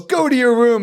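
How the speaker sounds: scolding parent. Retold or imitated. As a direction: bark it like a parent sending a kid off.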